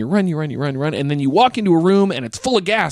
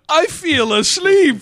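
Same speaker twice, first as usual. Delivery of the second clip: high, slow drowsy mumble; mock just-woken-up slur; hammed-up sleepy waking line; groggy waking voice; 12 semitones higher, 3 dB louder, much slower than usual. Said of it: "And then he wakes up".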